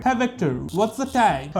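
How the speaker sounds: slow, drawn-out American-accented voice command; mock American accent for a voice assistant. Shown as a demonstration. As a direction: stretch each word in a put-on American accent. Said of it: "ऐसा accent में ही बोलना होता है".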